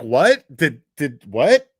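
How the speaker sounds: loud mock bewilderment; mock confusion. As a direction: imitate her puzzled reaction. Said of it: "she's like".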